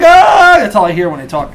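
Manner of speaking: usual speaking voice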